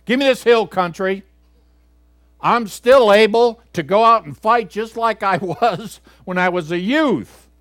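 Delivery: put-on old Caleb's bold claim. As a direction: claim the hill country like a fighter. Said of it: "but it's better".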